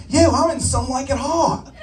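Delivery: high-pitched comic starlet voice; Marilyn Monroe character. Acted. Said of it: "my character, Marilyn Monroe".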